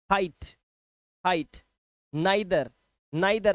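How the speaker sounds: हाइट as "hite", neither as "nigh-ther"; eye sound. Shown as a demonstration. Teacher modelling हाइट and neither, eye sound. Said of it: "आई की आवाज आ रही है".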